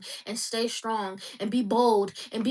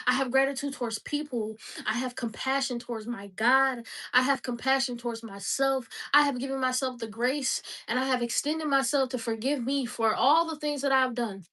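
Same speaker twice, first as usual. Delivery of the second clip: ordinary talk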